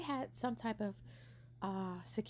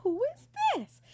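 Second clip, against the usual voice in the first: high, loud startled cry; alarmed shout; 10 dB louder than usual. Retold or imitated. Hearing a stranger scream in the house, shouting back alarmed.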